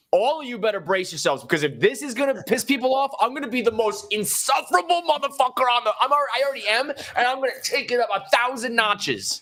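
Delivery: high, building vow of gloating; worked-up boast. Retold or imitated. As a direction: rise high into a defiant boast. Acting out his future boastful vow.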